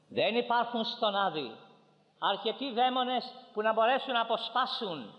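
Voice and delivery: high, ringing sermon declaration; emphatic preaching. Acted. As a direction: proclaim it high and firm, easing at the close. Preaches at full force.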